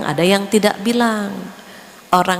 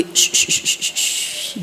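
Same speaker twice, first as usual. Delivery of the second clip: soothing shush; 3 dB quieter, slower than usual. Retold or imitated. Lulling someone to sleep.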